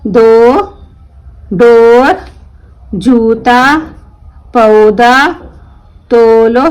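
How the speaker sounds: each word read out loud, one by one, with a pause after it; slow word-list reading. Shown as a demonstration. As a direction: read every word clearly for the class. Reads word list aloud. (धीमे और साफ़ शब्द पढ़ना)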